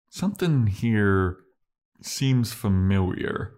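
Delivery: slow, drawn-out, suspicious musing; suspicious slow drawl. Retold or imitated. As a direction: let each word hang slowly, like sensing a trap. Spike's suspicious line, retold.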